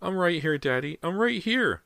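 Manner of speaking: put-on high, small scared child; tiny frightened voice. A narrator's reading. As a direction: answer like a frightened little boy. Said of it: "the tiny, frightened voice said".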